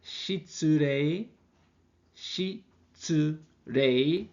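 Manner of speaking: Shitsurei stretched very slowly with a rise at the end; slow formal demo. Shown as a demonstration. Teacher modelling formal excuse me for students, very slow. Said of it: "in a more formal way".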